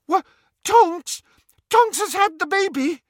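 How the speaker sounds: very high, loud astonished shriek. A narrator's reading. Friend hearing of the baby's birth, shrieking. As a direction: squeal each question in disbelief. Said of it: "Hermione shrieked".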